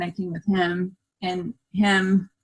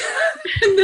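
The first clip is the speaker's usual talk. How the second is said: laughing. Real, not acted. Laughing while retelling.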